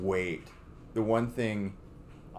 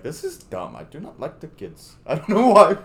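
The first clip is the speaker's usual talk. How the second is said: mock Indian-accented voice, ending high and loud; a jokey Gandhi accent for the first lady; Gandhi-like Melania; 6 semitones higher, 7 dB louder than usual. A podcaster mocking Melania at her event, Gandhi-style accent. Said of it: "why did you make her sound like Gandhi there?".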